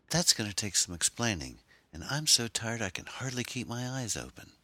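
put-on high, tired young woman's voice; weary girl. A narrator's reading. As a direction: sigh it out, exhausted. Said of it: "shook her head wearily".

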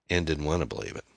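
usual speaking voice

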